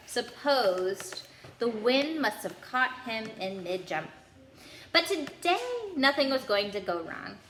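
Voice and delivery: plain reading voice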